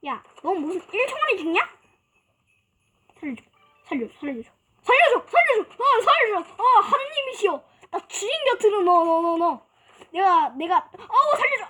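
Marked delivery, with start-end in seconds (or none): 3.2-11.7 terrified horror victim. Acted. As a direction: beg for your life. Begging in panic while dying.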